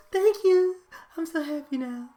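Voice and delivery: high-pitched mock-happy baby voice; pacified infant. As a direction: imitate a baby suddenly soothed, squeaky at first. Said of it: "you're being an infant with them".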